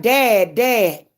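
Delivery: mock calling through the door; retold call at the door. Banging on father's door, calling.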